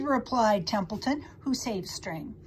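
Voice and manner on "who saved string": ordinary delivery, nothing marked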